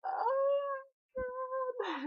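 high, slow, drawn-out and choked up; tearful gasp. Retold or imitated. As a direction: let it crack as the tears come. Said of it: "I started to cry".

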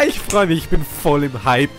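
normal talking voice

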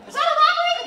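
high, clueless question; clueless cop. Retold or imitated. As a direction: play dumb with the voice pushed way up. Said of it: "I don't want you to, like".

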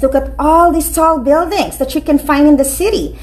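ordinary delivery, nothing marked

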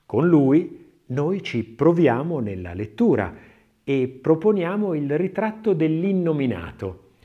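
ordinary delivery

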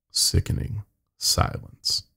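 usual speaking voice

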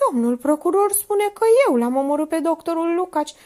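high plaintive complaint; on the verge of sniveling; whimpering. A narrator's reading. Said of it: "începu să se smiorcăie".

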